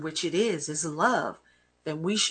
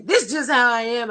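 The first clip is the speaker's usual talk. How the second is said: defiant quote; 6 semitones higher, 7 dB louder than usual. Retold or imitated. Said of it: "Anytime somebody tell you".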